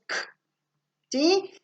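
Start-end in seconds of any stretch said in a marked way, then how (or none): 0.0-0.1 "k" as a bare throat click, no vowel; isolated k sound. Shown as a demonstration. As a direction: make only the consonant sound of "k". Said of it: "tiene dos sonidos".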